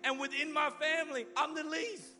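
high, insistent protest; protesting Gideon. Acted. Gideon doubts God's call to save Israel, high agitated protest. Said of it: "Gideon replied".

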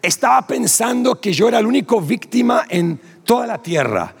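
usual speaking voice

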